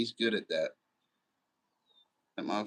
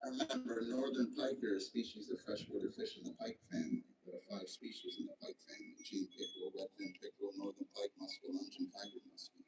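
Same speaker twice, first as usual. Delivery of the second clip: quiet; 15 dB quieter than usual. Real, not acted. Reads out fish facts.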